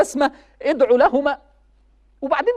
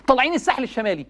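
raised, pitched-up sermon voice; impassioned preaching; 4 semitones higher than usual. Acted. Preacher urging young people, impassioned. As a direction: preach it high and urgent to the crowd. Preacher warns youth about mothers.

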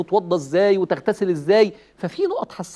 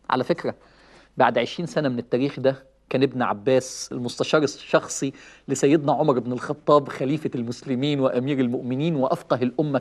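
normal talking voice

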